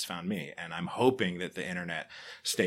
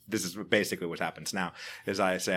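ordinary delivery

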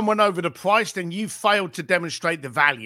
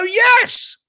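much higher, louder excited yes; eager yes; 9 dB louder than usual. Retold or imitated. Homeowner begs the salesman to stop the pain, excited shout. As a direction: blurt it out in relief.

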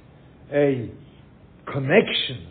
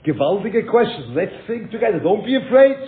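raised, emphatic voice rallying the student; encouraging teacher; 5 semitones higher, 5 dB louder than usual. Acted. Teacher answering a pupil's question, loudly encouraging. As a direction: urge them on with higher, louder energy. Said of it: "you would handle the question like this".

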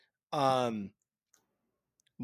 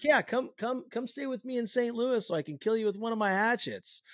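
mock killer invite; 7 semitones higher than usual. Mock creepy invite, hatchets.

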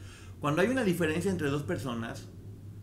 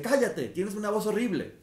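higher mock snap at the child; tired mother's sharp put-down, higher; 7 semitones higher, 3 dB louder than usual. Tired mother silences her singing daughter, mock angry snap. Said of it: "la mamá voltea y le dice".

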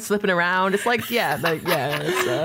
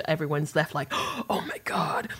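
breathless whisper of an awestruck player; gasp, then whisper oh my God; awed whisper; 4 semitones lower, 7 dB quieter than usual. Shown as a demonstration. Players left speechless, whispering in awe. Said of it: "whispered gasp".

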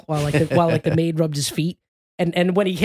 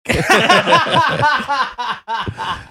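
real uncontrollable laughing fit; high, loud cracking-up laugh; loud burst of laughter; 8 semitones higher, 6 dB louder than usual. Real, not acted. Hysterical laughter after a joke.

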